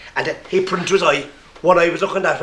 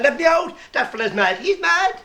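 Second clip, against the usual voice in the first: high hammed-up scream of fright at the mad doctor; mock scream; 11 semitones higher than usual. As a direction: panic to get out.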